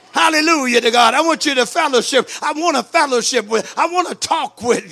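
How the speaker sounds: loud, fervent hallelujah burst; full-force preaching. Acted. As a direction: lift the church with it. Preacher erupting in praise.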